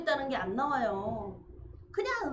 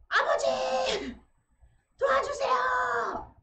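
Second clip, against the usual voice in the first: long, high, loud cry for father; desperate shout; 13 semitones higher, 5 dB louder, much slower than usual. Retold or imitated. Son screaming for help as father collapses, desperate shout. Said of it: "하고 소리를 질렀어요".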